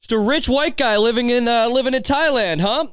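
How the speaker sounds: high-pitched calling out; mock heckling. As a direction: call across the room, needling the guy. Acts out hailing the dad onstage.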